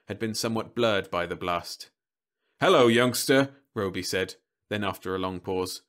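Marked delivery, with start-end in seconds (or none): 2.6-3.6 high, loud chirpy greeting; robot salesman voice. Acted. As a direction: greet the kid like a vending machine.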